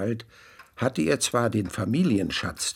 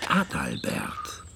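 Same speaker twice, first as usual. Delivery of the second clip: low slow whispered name; ominous whisper; 10 semitones lower, 4 dB quieter, slower than usual. A narrator's reading. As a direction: breathe the name with menace. Narrator names villain darkly.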